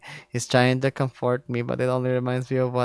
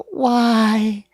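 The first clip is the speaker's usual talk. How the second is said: ragged voice; 8 semitones higher than usual. Acted. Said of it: "My voice is so ragged it's barely audible".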